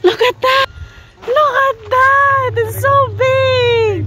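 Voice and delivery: high excited look at that; excited pointing out. Real, not acted. Parent marvels at dinosaur.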